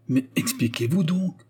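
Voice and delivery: a touch of a frantic outcry; exclaiming. A narrator's reading. Léon presses the concierge to explain, mildly frantic exclamation. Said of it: "s'écria Léon".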